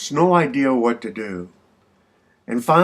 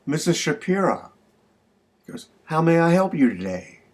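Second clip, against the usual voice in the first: lightly voiced store clerk, higher pitch; clerk greeting; 6 semitones higher than usual. Grocery clerk offering help to a customer, lightly acted high greeting. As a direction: lift the pitch a little, like a helpful clerk.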